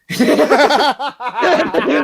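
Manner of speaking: amused laughter breaking through the story; laughing. Real, not acted. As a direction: burst of high laughter at his own story. Laughing at own punchline.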